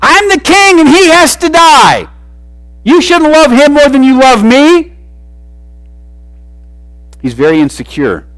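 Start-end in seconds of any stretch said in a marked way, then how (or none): 0.0-4.9 much higher and louder, whiny royal tantrum; tantrum king. Retold or imitated. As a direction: stamp your feet like a spoiled king. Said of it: "throwing a tantrum".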